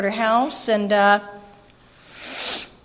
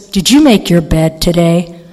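sponsor deadpan; 6 semitones lower, 8 dB louder than usual. Retold or imitated. Sponsor cuts in calmly.

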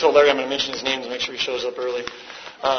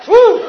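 loud, very high "woo"; mock impressed whoop; 12 dB louder than usual. Bragging about six months sober, whooping.

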